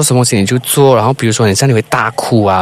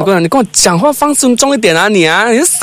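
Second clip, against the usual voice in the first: higher, indignant demand for respect; mock angry; 7 semitones higher than usual. Host acting angry at the caller, mock indignant. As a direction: pretend to scold the sister. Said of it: "acting out the argument".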